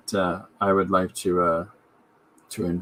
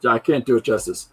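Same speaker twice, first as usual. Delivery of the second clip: ordinary talk, nothing marked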